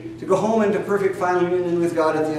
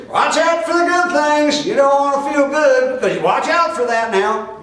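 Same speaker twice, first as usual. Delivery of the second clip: mock alarm; 9 semitones higher, 3 dB louder than usual. Preacher mocking someone warning against good things, high fussy voice. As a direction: play a nervous busybody raising a red flag. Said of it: "and say".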